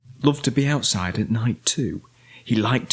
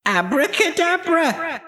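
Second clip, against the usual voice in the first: high, ringing witch's incantation; shouted magic spell. A narrator's reading. Winnie waves her magic wand, high shouted spell. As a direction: cry the spell out high, holding the last sound. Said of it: "waved her magic wand and shouted".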